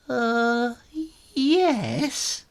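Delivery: high, hesitant yes; nervous kitten. A narrator's reading. Muncher nervously agreeing to join, high. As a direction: squeak a timid agreement.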